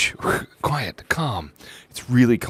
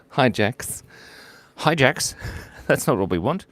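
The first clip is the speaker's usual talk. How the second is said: normal talking voice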